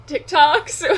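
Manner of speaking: loud laughing, high; laughing through the words. Real, not acted. Excited about the vlog.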